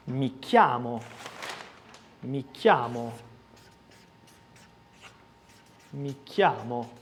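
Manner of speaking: chiamo said as kiamo, hard K; hard K sound. Shown as a demonstration. Teacher drilling the CH sound in chiamo. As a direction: repeat chiamo with a clean K. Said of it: "il suono è questo di ch".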